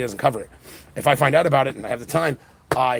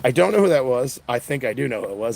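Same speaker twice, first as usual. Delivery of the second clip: ordinary talk, nothing marked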